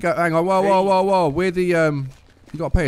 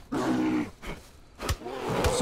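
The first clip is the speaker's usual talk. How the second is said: mock deep voice; 9 dB quieter than usual. Taunting the bear.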